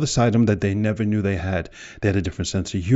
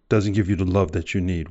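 ordinary talk

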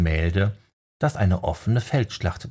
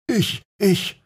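hoch und verlegen gestammelt; stammelnd; 12 semitones higher than usual. A narrator's reading. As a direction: vor Verlegenheit stottern. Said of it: "stammelte er". (ertappter Fan stammelt)

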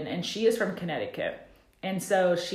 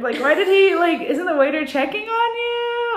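much higher, louder incredulous question; 11 semitones higher, 6 dB louder than usual. Retold or imitated. Recalling her reaction to Tom's dinner date, high and baffled. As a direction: squeak out the confusion.

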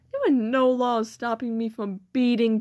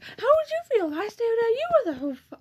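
mock teacher; 10 semitones higher, faster than usual. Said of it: "then he was like".

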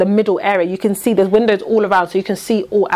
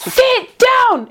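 high-pitched mock yell, drawn from a retelling; retold shout; 12 semitones higher than usual. Retelling an officer's outburst at a woman, mock shouting. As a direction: imitate an officer shouting an order. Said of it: "Shouting in her face".

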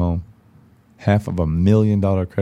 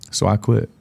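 ordinary talk, nothing marked

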